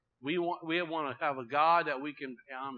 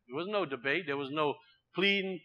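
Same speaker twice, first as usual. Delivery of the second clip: ordinary talk, nothing marked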